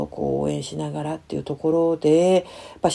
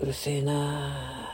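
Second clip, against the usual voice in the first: low, slow, sulky grumble under the breath; sullen mutter; 4 semitones lower, 11 dB quieter, slower than usual. Retold or imitated. Teen son muttering back at his nagging mother, sulky and low. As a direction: mumble it like a teen who won't engage. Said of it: "っていう感じで".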